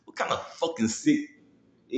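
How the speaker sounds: normal talking voice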